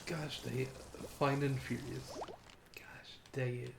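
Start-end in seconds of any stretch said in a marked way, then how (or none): 2.7-3.7 slow breathy whisper. Real, not acted. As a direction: whisper it under your breath. Same words repeated whispered.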